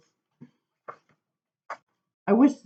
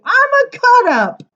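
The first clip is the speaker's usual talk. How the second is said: much higher, bouncy boast; chatty kid boasting; 12 dB louder than usual. Acted. Shy child revealing the inner joker, much higher playful boast. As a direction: show off like a class clown. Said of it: "I'll say".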